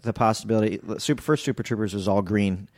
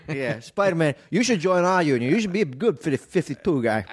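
higher hammed-up union guy; union worker; 6 semitones higher than usual. Union guy recruiting Spider-Man, higher hammed-up pitch. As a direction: recruit him in a mock blue-collar voice.

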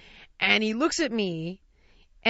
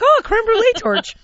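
high, loud, delighted gasp of recognition; light up like a kid seeing dessert; a burst of giddy glee over the torch; excited squeal; 13 semitones higher, 9 dB louder than usual. Retold or imitated. Ex-fat kid spots dessert torch.

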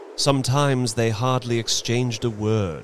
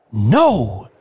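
loud, ringing refusal; triumphant cry; 3 semitones higher, 8 dB louder than usual. A narrator's reading. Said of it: "she cried triumphantly".

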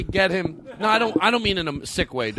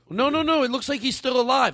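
family member voice; 9 semitones higher than usual. Retold or imitated. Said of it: "they're like".

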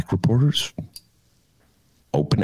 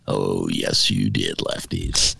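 lower scolding voice; slower than usual. Pretend. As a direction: talk down to the lefties, slow and low. Answering the mock lefty voice.

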